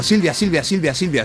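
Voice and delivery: pleading repetition. Retold or imitated. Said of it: "así repitiéndolo muchas veces seguidas".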